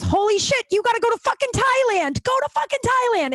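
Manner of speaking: very high-pitched, thrilled exclamation; squealing hype-up; excited outburst. Retold or imitated. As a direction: imitate an ecstatic friend urging you on, voice way up high. Retelling sister's thrilled reaction.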